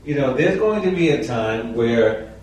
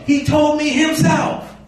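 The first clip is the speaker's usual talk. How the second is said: "He told me himself" much higher and slow, "told" leaned on; stress on himself; slower than usual. Shown as a demonstration. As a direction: hit "told" and "himself" hard. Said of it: "you put that emphasis on".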